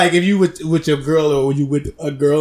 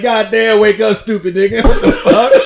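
louder, rising, laughing yell; mock yelling; 6 semitones higher, 6 dB louder than usual. Waking the snoring friend, laughing yell. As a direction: bark at the snoring roommate, laughing. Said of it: "I'm like".

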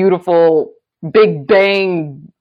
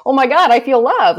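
tearful joy; 8 semitones higher, 5 dB louder than usual. Retold or imitated. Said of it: "There's tears of".

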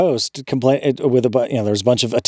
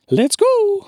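squeaky high Mario catchphrase, bright and bouncy. Retold or imitated. Mocking the voice actor's Mario line, high Mario impression. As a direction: chirp it like the plumber himself. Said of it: "he's good at saying".

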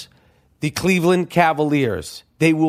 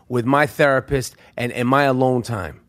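ordinary talk, nothing marked

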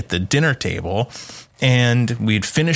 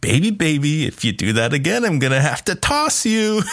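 rising into a much higher mock threat; teasing parent-style threat, climbing higher; mock stern warning; 6 semitones higher than usual. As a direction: playfully warn the doll. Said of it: "I'm like".

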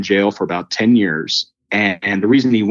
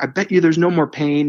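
higher, eager and certain; excited; 8 semitones higher than usual. Retold or imitated. Telling the homeless man he's pain free, eager and high. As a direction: bet on the miracle, buzzing. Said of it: "total freak out mode".

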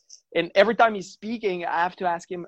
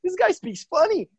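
imitating a baffled foreigner, pitched up high; mock amazed listener; 11 semitones higher than usual. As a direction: squeak out a mock-amazed "this guy". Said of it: "This is what on this side of the world they're gonna say".

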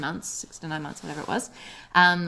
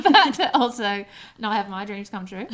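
ordinary talk

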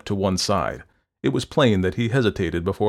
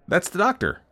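high-pitched urgent call; excited cry; 11 semitones higher, 4 dB louder than usual. A narrator's reading. Landlord calling out that the doctor is here, an excited cry. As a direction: cry it out as an alert. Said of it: "cried the landlord".

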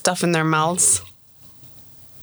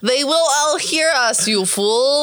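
high, hammed-up scolding; mock screaming; 7 semitones higher than usual. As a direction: scold the noisy party in character. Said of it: "screaming at each other to be quiet".

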